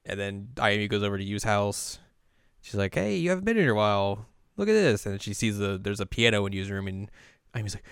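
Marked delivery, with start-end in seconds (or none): 3.0-5.0 high, quick, chirpy welcome; lightly acted eager hello; friendly greeting.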